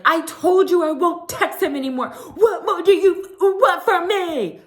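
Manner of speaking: high, loud, mocking outburst; mock angry yell. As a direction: ham up her shouting at Dorit. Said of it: "she's like".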